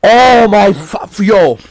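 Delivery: high, loud, slow exasperated cry; pissed-off outburst. Real, not acted. Angry gamer exploding about Sony's choices, loud outburst. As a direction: boil over and cut yourself off. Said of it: "I'm so fucking pissed now".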